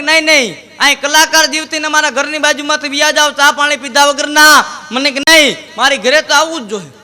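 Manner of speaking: pushy neighbour insisting on tea; insistent invitation. Retold or imitated. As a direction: press him to come over, loudly. Host insists he come home.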